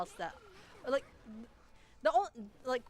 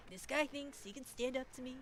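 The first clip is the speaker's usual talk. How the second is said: Donald Trump impression; 3 semitones higher, 6 dB quieter than usual. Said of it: "my Donald Trump impression".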